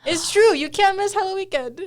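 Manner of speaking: high, pushy hype voice; echoing the hype. Retold or imitated. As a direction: mimic the influencer ad.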